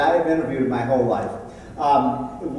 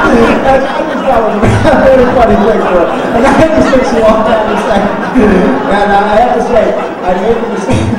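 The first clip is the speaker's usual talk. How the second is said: higher and louder, words through laughter; laughing while talking; 6 semitones higher, 11 dB louder than usual. Real, not acted. Speaker tells the crowd while laughing. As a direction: still cracking up. Still laughing after punchline.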